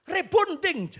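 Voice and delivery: rebonding called out high and loud for boarding; mispronounced call. Retold or imitated. Wife calling her husband to board, loud and high. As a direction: shout rebonding as the wrong word.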